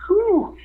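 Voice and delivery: high, exhaled whew of relief; relieved whew. Retold or imitated. Rookie recovering from the choke, high relieved whew.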